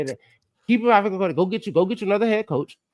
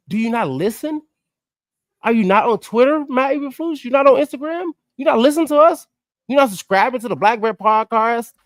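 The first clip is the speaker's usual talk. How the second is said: exasperated call-out; 8 semitones higher, 4 dB louder than usual. Acted. Calling out the coach directly.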